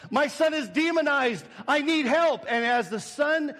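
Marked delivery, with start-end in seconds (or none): 0.1-2.5 higher-pitched desperate plea, raised voice; imitated yell. Dad bursts in begging his pastor for help, imitated yell. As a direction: call out for help as the panicked dad. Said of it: "and he yells out".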